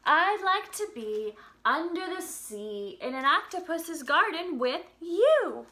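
slow, sing-song storybook reading; reading to children. Acted. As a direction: read the lyrics gently to little kids. Reads song lyrics to children.

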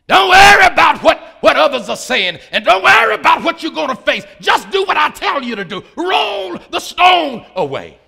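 shouted, high-pitched sermon exhortation; thundering pulpit cry; full-force preaching. Acted. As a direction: shout it like a preacher commanding, stretching the final command.

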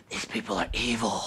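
low, hushed ominous voice; voice in the head. Retold or imitated. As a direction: imitate an eerie inner voice, soft and low. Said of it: "I heard a voice in my head say".

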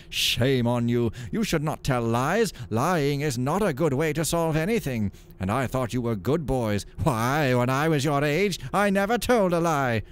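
a hint of scolding friar; admonishing monk. Monk scolding the boys, mild reproach. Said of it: "admonished the boys".

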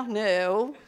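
ordinary talk

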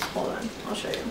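whispered aside. Real, not acted. Whispered aside while fetching item.

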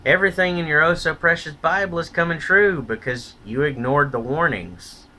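normal talking voice

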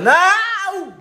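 loud, high outburst of protest; shouted no. Real, not acted. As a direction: yell it in shocked refusal. Reacting to a cheeky question.

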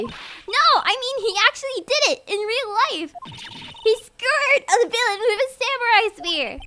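high, emphatic alarm; agitated insistence. Acted. Maya correcting Nick about real life, agitated. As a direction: insist urgently, pitched well up.

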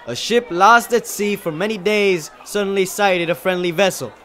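high, loud, fervent declamation; shouted oration. Acted. Student extolling a great leader, shouted speech. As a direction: project it like an earnest orator. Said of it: "I shouted".

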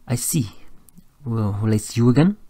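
put-on French-accented, hopeful rising question. A narrator's reading. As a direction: answer gently in a French accent. Pierre, the accented Frenchman, replies.

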